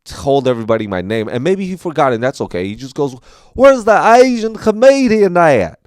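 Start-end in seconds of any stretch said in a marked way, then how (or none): 3.5-5.8 very loud, high-pitched Southern bellow; drunk Southern accent. Retold or imitated. Drunk fan looking for the comedian, loud bad Southern accent. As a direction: bellow it with exaggerated Southern vowels. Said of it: "Southern accent".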